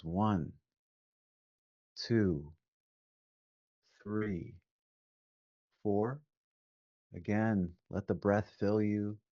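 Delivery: usual speaking voice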